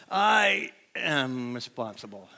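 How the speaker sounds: slow, soft, defeated; falling-apart version. Shown as a demonstration. Preacher showing how it sounds when life falls apart, slow defeated murmur. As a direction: say it slowly and softly as if crushed. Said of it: "a little harder to say when things are falling apart".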